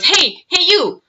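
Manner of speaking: high-pitched mock hail; calling out. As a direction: imitate a pest calling for attention. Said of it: "he calls out to me again".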